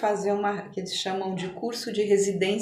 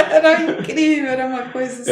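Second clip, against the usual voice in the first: laughing, higher and louder; 9 semitones higher, 10 dB louder, much faster than usual. Real, not acted. Delighted after recalling the choir's song, laughing. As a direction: laugh through the words.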